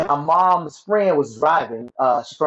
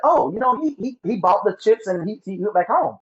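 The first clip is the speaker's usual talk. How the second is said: higher-pitched imitation of uncle; retold uncle's reply; 6 semitones higher than usual. Uncle answering mother at his store, retold reply in raised voice.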